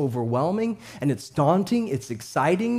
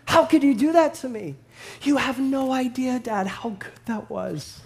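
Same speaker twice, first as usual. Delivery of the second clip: pretend toddler protest; 7 semitones higher than usual. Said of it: "it would have been something like this".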